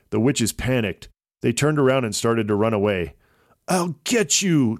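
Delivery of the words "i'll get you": high, slow shout after fleeing foes. A narrator's reading. Troll chasing the witches, high shout. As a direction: bellow it while chasing. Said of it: "Troll shouted".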